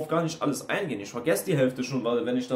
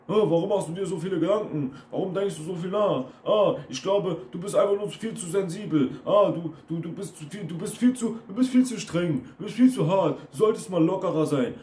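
higher-pitched mocking whine; mocking stock phrases; 5 semitones higher than usual. Coach mimics critics telling him he is too sensitive, mocking. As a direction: parrot their nagging, pitched up. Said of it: "Das sind dann diese Standardsprüche".

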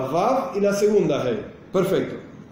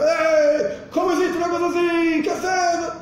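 angry shout; 11 semitones higher than usual. Acted. Worshipper yelling at the passer-by who bumped his elbow, angry shout. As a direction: bark it out like a man who was shoved. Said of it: "la persona empieza a gritar".

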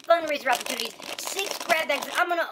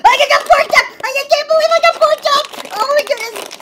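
loud, high squeal of joy; ecstatic; 10 semitones higher, 12 dB louder than usual. Real, not acted. Kid unboxing the favorite toy, ecstatic. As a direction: shriek it, overjoyed at the prize.

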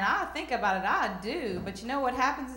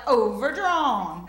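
slow, loud, drawn-out overdrawn; bank lady; 8 dB louder, much slower than usual. Retold or imitated. Comic imitating the bank voice announcing overdrawn, slow and loud. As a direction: stretch the word out slowly like a recorded verdict. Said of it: "And she's like".

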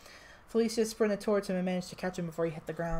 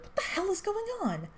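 shouting; 13 semitones higher than usual. A narrator's reading. Character shouting in a fight.

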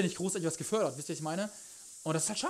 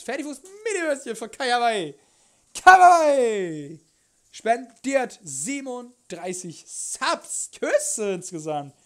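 slow, high and loud cutesy voice; cute anime streamer; 5 semitones higher, 11 dB louder, much slower than usual. Acted. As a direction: coo the donor's name sweetly. Thanking donor, cute voice. (süße Anime-Streamer-Stimme)

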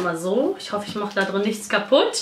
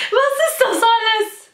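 amazed; 10 semitones higher, 3 dB louder than usual. Real, not acted. Amazed at the gifts.

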